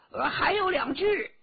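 high, loud shouted report; 高声叫道. Acted. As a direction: soldier calling back to his officer. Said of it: "叫道". (士兵大声喊着回报)

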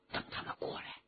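tense-moment whisper, very soft; 悄声耳语. Acted. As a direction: whisper to the companion lying beside you. Hidden man whispers instruction to companion. (压低嗓子悄声嘱咐)

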